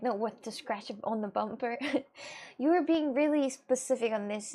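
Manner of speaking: ordinary delivery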